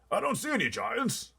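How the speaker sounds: high, loud frightened cry; a blustering general in a panic; terrified army chief. Acted. Army head crying out from the back seat, terrified.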